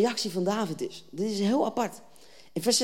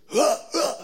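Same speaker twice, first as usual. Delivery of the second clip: short, loud, raised grunted shouts; imitated war cry; 4 semitones higher, 4 dB louder than usual. Armies walking towards each other, mock war cry. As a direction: grunt like soldiers advancing. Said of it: "dan roepen ze".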